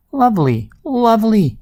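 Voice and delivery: high, falling sighs of delight; blissful pig. A narrator's reading. Small pig sinks into swamp mud, blissfully delighted. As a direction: savour each word, pitched well up.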